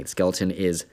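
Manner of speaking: ordinary talk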